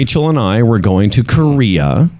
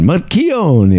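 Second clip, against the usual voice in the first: Marchione said higher with a hammed-up Italian lilt; mock Italian Marchione; 6 semitones higher than usual. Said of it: "start coming back going".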